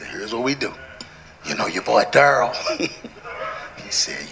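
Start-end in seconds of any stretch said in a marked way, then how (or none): 0.1-2.6 low, soft, confidential pitch; retold sly suggestion. Preacher retelling a player's workaround, low and hushed. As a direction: lay out the workaround low and quiet.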